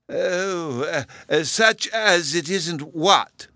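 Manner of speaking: high, very slow querulous query; drawn-out, irritable high professor's voice; peevish. A narrator's reading. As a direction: demand an explanation peevishly between ums. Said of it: "he peevishly inquired".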